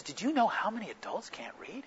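hushed whisper, as if sharing a secret; whispered question. Retold or imitated. Businessman tells the pastor about adults who can't read, whispering. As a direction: lean in and whisper it. Businessman confides a startling fact.

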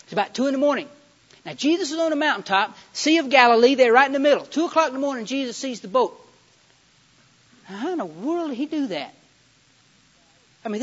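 loud, high-pitched sermon storytelling; full-force preaching. Real, not acted. Preacher telling of disciples sent out by boat, full force. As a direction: belt out the Bible story in punchy phrases.

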